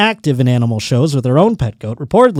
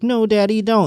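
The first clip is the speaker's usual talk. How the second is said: higher, light imitation of a little girl; pleading little girl; 9 semitones higher than usual. Little girl begging her daddy to stop, imitated pleading. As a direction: plead like a small daughter, pitched up. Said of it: "then the little girl".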